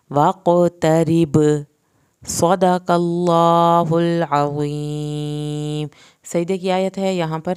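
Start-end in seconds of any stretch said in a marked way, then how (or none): 0.1-1.4 "واقترب" slow and louder, with a thin r; light r. Shown as a demonstration. Teacher to students: slow, light r. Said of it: "را پتلا پڑا جائے گا". 2.3-5.9 slow, louder closing chant; slow recitation. Shown as a demonstration. Teacher to students: slow solemn chant.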